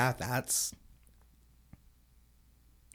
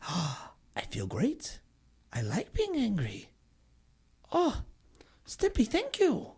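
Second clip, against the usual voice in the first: Ren delighted; 8 semitones higher than usual. Retold or imitated. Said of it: "Rin's like".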